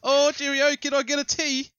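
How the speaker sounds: high, loud put-on British accent; mock British accent. Friends joking about moving to London, mock British accent.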